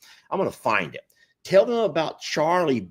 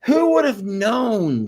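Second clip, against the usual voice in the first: laughing; 12 semitones higher, 7 dB louder, slower than usual. Real, not acted. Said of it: "still laughing".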